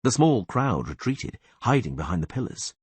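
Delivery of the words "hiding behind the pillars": ordinary delivery, nothing marked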